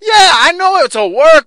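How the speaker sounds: laughing shout, pitched high; bursting out laughing at the cheesy promo; loud laughing. Real, not acted. Host cracking up on air, laughing loudly.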